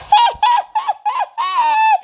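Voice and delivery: a big, high-pitched cackle; messy high laugh. Retold or imitated. Host imitates Heavenly's messy laugh, high cackle.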